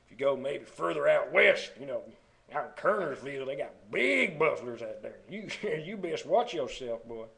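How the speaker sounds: loud, exaggerated backwoods North Carolina accent; rural Kernersville accent. Shown as a demonstration. Vlogger imitating Kernersville locals, hammed-up rural Southern accent. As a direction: ham up a country Southern accent from further out west. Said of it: "don't talk like that".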